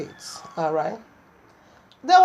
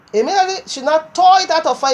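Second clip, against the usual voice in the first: excited; 7 semitones higher, 5 dB louder, faster than usual. Real, not acted. Climax of the deliverance story.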